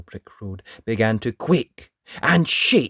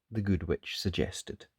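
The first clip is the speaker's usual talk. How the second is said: usual speaking voice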